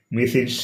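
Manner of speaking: మిసిస్ said as "mi-siz"; Mrs. pronunciation. Shown as a demonstration. Teacher demonstrating MRS to students, మిసిస్ as "misiz". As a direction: end మిసిస్ on a buzzed Z. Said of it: "ఎలా పలకాలంటే".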